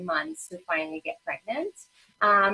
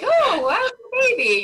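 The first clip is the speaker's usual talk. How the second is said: high, loud, hammed-up delight; mock gushing; 8 dB louder than usual. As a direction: overdo the wonder to break the silence. Mom making awkward small talk.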